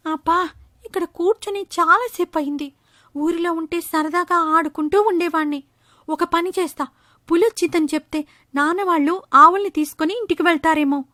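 put-on a mischievous kid plotting; boy bored up in the tree. Bored boy thinking aloud.

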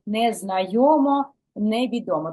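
ordinary talk

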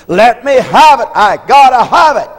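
loud, high desperate begging; desperate cry for it; desperate pleading. Acted.

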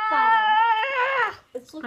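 slow loud excited call; excited shout. Real, not acted. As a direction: shout it out, thrilled.

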